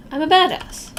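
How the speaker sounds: hammed-up fake courage; mock bravado. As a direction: pump yourself up, hamming it.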